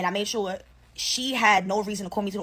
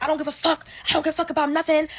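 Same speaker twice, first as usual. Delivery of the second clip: retold yelling; 5 semitones higher, faster than usual. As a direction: blow up and let it out. I guess with us yelling.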